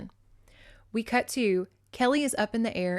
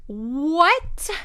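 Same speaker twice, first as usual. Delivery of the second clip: high, loud drawn-out disbelief; incredulous; 7 semitones higher, 8 dB louder than usual. Acted. Recapper stunned by who is flying, incredulous. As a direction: let it climb into a loud, baffled question. Reacting to absurd plot twist.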